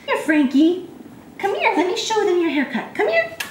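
high sing-song dog call. Real, not acted. Calling Frankie to show his haircut, high coaxing call.

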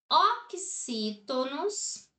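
slow spelled-out term. Shown as a demonstration. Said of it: "teacher names word classes".

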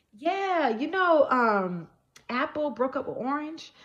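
a hint of a nosy neighbor's lilt; friend's gossip. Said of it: "randomly, she's like".

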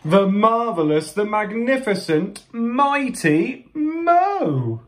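high, triumphant, building to a long-held name; hammed-up hero's fanfare; grand announcement. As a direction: proclaim yourself like a ringmaster, stretching the finish. Hero announces himself grandly.

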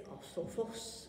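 "Asophos" slow and breathed, almost voiceless; whispered Erasmian pronunciation. Retold or imitated. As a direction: imitate a YouTube pronunciation guide saying "Asophos" softly.

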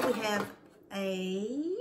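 ordinary talk

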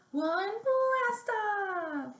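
big high shout of blast off; excited blast off. Acted. Teacher shouting the rocket launch to the kids, excited.

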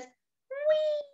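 very high, squeaky little bird cry of joy; squeaky bird whee. Acted. Bird on the trampoline, very high squeaky whee. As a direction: squeal it like a tiny delighted bird. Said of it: "And the bird says".

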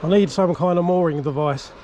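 ordinary talk, nothing marked

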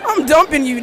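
ordinary talk

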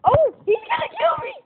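high, loud cry of alarm; alarmed yell. Real, not acted. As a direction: yell as if about to be caught. About to be killed in game.

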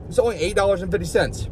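usual speaking voice

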